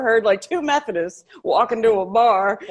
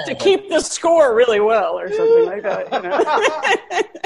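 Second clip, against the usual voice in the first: laughing through the words, pitched up; 7 semitones higher, 4 dB louder, slower than usual. Real, not acted. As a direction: keep laughing as you finish the joke. Laughing while joking about stereotypes.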